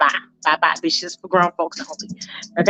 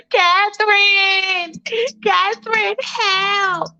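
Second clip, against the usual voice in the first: high, slow, drawn-out call for Catherine; Michael panics and calls Catherine, high and drawn out; panicked cry for help; 10 semitones higher, much slower than usual. Retold or imitated. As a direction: wail for help like a scared kid.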